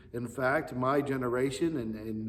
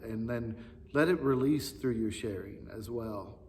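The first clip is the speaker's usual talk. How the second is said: ordinary talk, nothing marked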